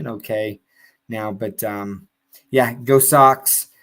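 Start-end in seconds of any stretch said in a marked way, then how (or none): none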